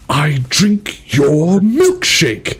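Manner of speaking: slow, high villain quote; menacing villain. Retold or imitated. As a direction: gloat like a movie villain. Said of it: "Johan's up there like".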